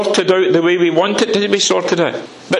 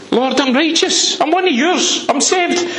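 much higher, earnest insistence; pleading protest; 9 semitones higher than usual. Acted. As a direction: insist to God you are his, up high. Acting out David's plea.

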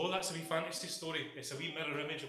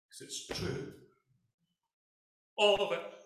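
emphatic declaration; 7 semitones higher, 9 dB louder, slower than usual. Acted. Preacher echoing the Han Solo line.

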